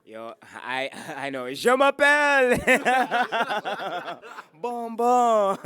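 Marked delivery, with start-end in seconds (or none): 1.5-5.6 हंसते हुए ऊँची, तेज़ नकली फ्रेंच; बढ़ा-चढ़ाकर फ्रेंच की नकल, ठहाकों के साथ; mock French laughing. Joking about knowing French.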